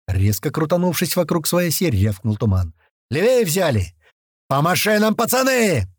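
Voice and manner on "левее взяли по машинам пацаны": high-pitched commanding yell, rising; rally the crew to the vehicles; urgent shouted command; bellowed order. A narrator's reading. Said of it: "заорал во всё горло Туман".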